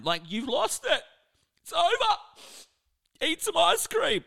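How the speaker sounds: high, dramatic mock sympathy; mock consoling friend. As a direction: play the over-the-top friend comforting a heartbroken teen. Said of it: "you often have your friends come around you and try and give you reason".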